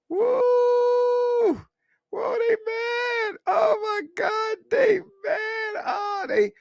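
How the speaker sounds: very high, shrill falsetto squeal; mock shriek. Streamer mocking angry commenters in a high falsetto shriek. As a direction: squeal it like an overexcited fan.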